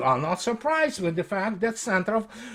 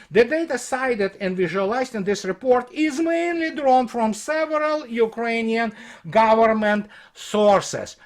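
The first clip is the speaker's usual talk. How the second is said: reading out a report, slightly raised; report read aloud; 6 semitones higher, 3 dB louder than usual. Acted. Commentator reads critics' report to his audience, formal read-aloud.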